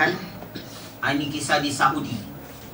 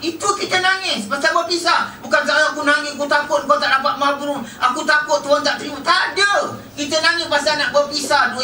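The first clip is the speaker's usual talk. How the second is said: preaching at full force; 10 semitones higher than usual. Acted. Preacher to the mourners about parting, fiery preaching. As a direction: drive the point home to the hall.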